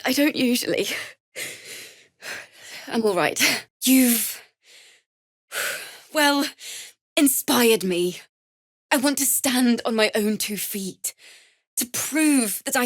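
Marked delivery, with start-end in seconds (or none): none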